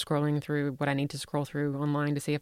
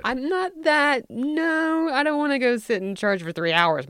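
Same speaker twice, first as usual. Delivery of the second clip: daughter's protest; 9 semitones higher, 4 dB louder than usual. Retold or imitated. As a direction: play the kid refusing church. Daughter voiced refusing church.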